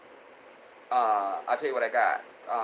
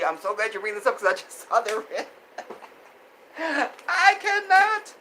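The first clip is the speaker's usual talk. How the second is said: laughing; 10 semitones higher, 6 dB louder than usual. Real, not acted. Laughing at viewer comment.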